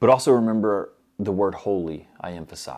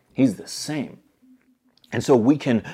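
ordinary talk, nothing marked

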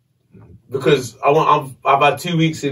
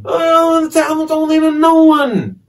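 angry shouting; 10 semitones higher than usual. Retold or imitated. To the man with power, a high furious shout. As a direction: push him off and shout, pitch way up. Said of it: "I kinda pushed him away now".

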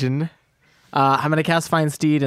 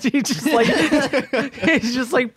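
laughing; 14 semitones higher than usual. Real, not acted. Laughing while describing friend.

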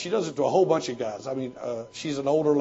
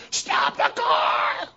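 retold scream; much slower than usual. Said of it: "He would scream".

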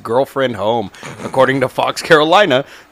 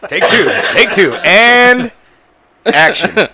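director calling take; 9 semitones higher, 6 dB louder, slower than usual. Pretend. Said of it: "calling a retake".

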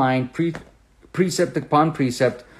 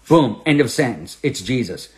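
ordinary talk

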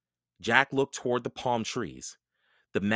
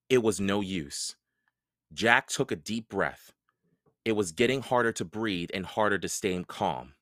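ordinary delivery

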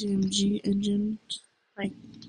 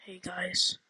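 usual speaking voice

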